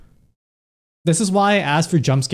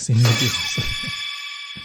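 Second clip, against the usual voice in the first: squeaky high laugh; 4 dB quieter than usual. Real, not acted.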